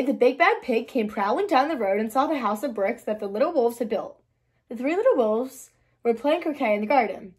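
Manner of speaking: usual speaking voice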